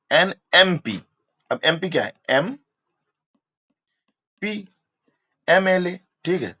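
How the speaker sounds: normal talking voice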